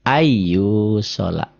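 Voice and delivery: "Ayu sholat" recited very slowly with a long held y; n merged into y. Shown as a demonstration. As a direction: hold "Ay" and stretch "yu" in "Ayu sholat". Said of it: "an jadi ai".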